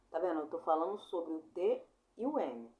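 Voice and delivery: ordinary talk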